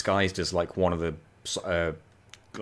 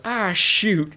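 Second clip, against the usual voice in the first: hammed-up dismay, pitched way up; Mater's dismayed outburst; 13 semitones higher, 6 dB louder than usual. Retold redneck character's dismayed outburst.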